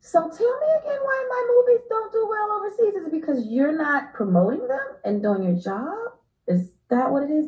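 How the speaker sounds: pointed, fast, mock-innocent question; sarcastic challenge. Confronting the studio about promoting her movies, sarcastic. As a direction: needle them with fake innocence. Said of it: "And I'm like".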